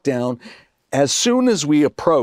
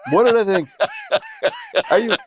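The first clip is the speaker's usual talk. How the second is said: much higher, laughing mock amazement; faked rave; 10 semitones higher than usual. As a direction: sell the lie with gushing awe. Faking rave reaction to the play.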